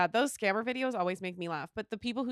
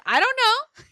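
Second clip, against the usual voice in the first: high, loud mock-mom brush-off; dismissive mom; 12 semitones higher, 10 dB louder, slower than usual. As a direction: throw it back loudly, unbothered. Mom brushing off daughter's worry.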